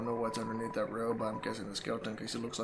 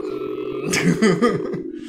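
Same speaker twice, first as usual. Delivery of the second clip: glitchy robot hum, much louder; malfunctioning robot voice; 13 dB louder than usual. Real, not acted. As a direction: buzz it like a broken robot. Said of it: "I sound like a malfunction robot".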